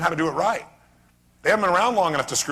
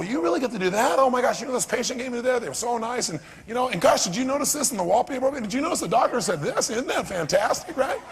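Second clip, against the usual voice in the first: higher, gushing newbie voice; mock excited beginner; 6 semitones higher than usual. Trainer voicing a brand-new hire, mock excited. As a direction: gush about every little thing.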